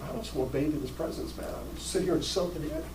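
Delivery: very soft, fast hush; hushed Mary. Retold or imitated. Sister at Jesus' feet, hushed and blissful. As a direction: breathe it like a blissful secret. Mary quoted soaking in presence.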